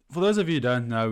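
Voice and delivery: ordinary talk, nothing marked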